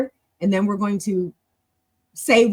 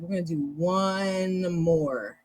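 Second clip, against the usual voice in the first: slow, lower, stretched-out words; drawn-out announcement; 3 semitones lower, 6 dB quieter, slower than usual. Real, not acted. Teacher announcing one more practice round to the class, drawn-out.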